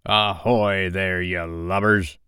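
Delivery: slow, drawn-out pirate captain's hail; long-stretched seafaring call; Hook imitation. A narrator's reading. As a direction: hail the crew like a commanding captain. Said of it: "he now imitated the voice of Hook".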